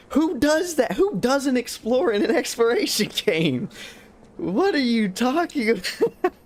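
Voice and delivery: high-pitched, laughing disbelief; can't believe what you're hearing; incredulous. Real, not acted. Streamer scoffing at chat over an exploration game, incredulous.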